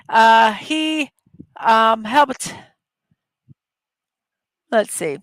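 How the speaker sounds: normal talking voice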